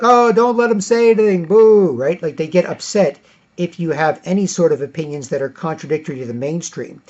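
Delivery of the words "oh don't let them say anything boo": high, loud mocking boo; hammed-up heckler booing. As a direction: jeer like an upset partygoer. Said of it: "people be like".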